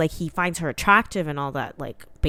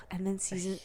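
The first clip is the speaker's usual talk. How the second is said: whisper; 13 dB quieter than usual. Real, not acted. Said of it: "sighs into a whisper".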